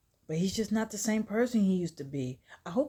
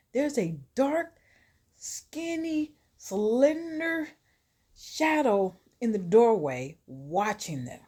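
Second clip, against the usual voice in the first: high, drawn-out suspense, dropping at the end; spooky sing-song narration with pauses; ominous storytelling; 7 semitones higher than usual. A narrator's reading. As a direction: tease the lurking danger word by word.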